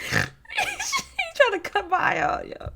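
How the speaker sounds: high, slow horrified wail; aghast at the story. Real, not acted. Reacting to the self-harm story, high horrified wail.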